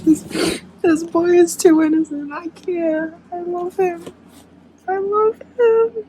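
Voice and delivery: high, cry-voiced adoration; tearful gushing. Real, not acted. Reader overcome by the innocent hero, high tearful gush. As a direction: let the voice climb high, close to crying.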